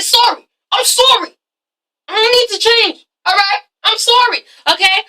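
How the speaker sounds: high, whiny mock apology; mocking Dre. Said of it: "He's like".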